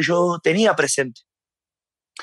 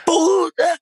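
sudden shouted tic; 11 semitones higher than usual. Retold or imitated. Man with Tourette's yelling at passing women, sudden high shouted tic. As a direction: blurt it out as an involuntary tic yell, pitched way up. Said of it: "Y yo".

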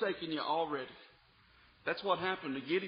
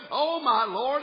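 rising, louder cry of O my Lord; Gideon's plea; 5 semitones higher, 6 dB louder, slower than usual. Acted. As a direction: call out to the Lord in distress. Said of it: "Gideon said unto him".

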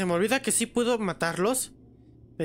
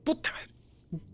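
susurrando; 3 semitones lower, 6 dB quieter, much slower than usual. Real, not acted. Detectado en sigilo.

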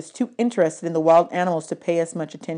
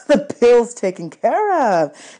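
laughing; 5 semitones higher, 6 dB louder, slower than usual. A narrator's reading. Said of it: "he said, laughing".

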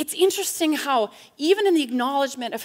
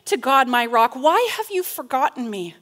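loud, raised plea to God; wrestling lament; 3 semitones higher, 5 dB louder than usual. Acted. Psalmist wrestling with God, forceful lament. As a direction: cry out the psalm's complaint forcefully. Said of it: "But still the wrestling".

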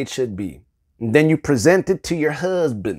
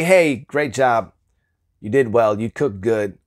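shallow praise; 6 semitones lower than usual. Shown as a demonstration. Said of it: "That's shallow".

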